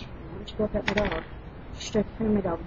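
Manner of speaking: ordinary delivery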